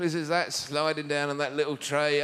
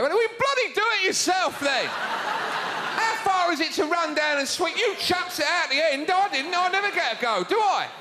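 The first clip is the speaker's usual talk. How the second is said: hammed-up petulant shouting; fed-up curling sweeper; 12 semitones higher than usual. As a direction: sulk like the overlooked teammate. Curling sweeper voiced in a huff.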